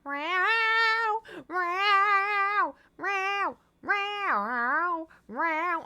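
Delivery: high, loud, long drawn-out cat meows; Thor meowing. Retold or imitated. Imitating her cat Thor yowling from the bedroom, high and loud. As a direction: yowl it out like a cat calling from the bedroom. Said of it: "and I hear".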